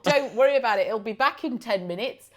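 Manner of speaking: high, loud, rushed breezy reassurance; mock cheerful reassurance. Teasing a friend who is cold, mock cheerful. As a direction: brush it off with hammed-up cheer.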